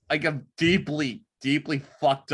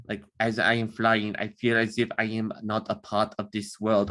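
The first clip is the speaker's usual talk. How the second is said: lightly voiced German aviator, quiet and solemn; German accent; 5 semitones lower, 3 dB quieter than usual. Shown as a demonstration. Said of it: "I can pull off a German accent".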